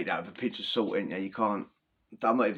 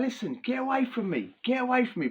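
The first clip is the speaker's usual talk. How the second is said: annoyed brush-off; 12 semitones higher than usual. Retold or imitated. People pushing him away.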